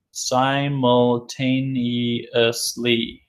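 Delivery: Simultaneously drawn out very slowly, syllable by syllable; teacher stretching out Simultaneously; slow model reading. Shown as a demonstration. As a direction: sound out Simultaneously slowly for the class.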